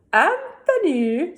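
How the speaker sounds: high, slow drawn-out call of his name; witch calling Anthony. Acted. Grandma Witch calls her helper, high and drawn out. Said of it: "said Stregonona".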